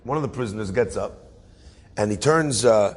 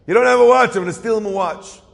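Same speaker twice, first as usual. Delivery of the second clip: much higher and louder, half-joking outburst; mock loud declaration; 8 semitones higher, 7 dB louder than usual. Prisoner insisting to the judge he'll steal a watch, mock loud. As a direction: belt it out like a stubborn promise.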